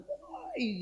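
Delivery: high, shrill shout at the driver; angry shout. Retold or imitated. Woman on a motorbike yelling at the driver, high shout. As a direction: yell out to stop him. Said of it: "dia gitu".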